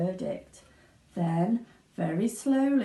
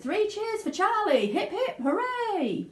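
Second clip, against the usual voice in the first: high, laughing cheer; 11 semitones higher than usual. Acted. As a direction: lead the hip hip hooray. Victory dance, cheering.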